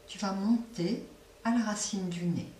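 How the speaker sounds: ordinary delivery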